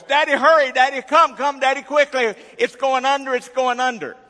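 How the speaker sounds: put-on high, loud, urgent little girl's cry; shrill frantic child. Daughter calling her dad to the sinking cork, shrill and frantic. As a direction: yell like a panicked daughter whose cork just sank. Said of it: "I hear this shrill voice".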